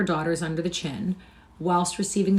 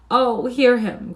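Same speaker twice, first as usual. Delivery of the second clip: slow, louder, mock-pained groan; 6 semitones higher, 7 dB louder, much slower than usual. A narrator's reading. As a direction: play the wronged sick brother. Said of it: "groaned Hugo".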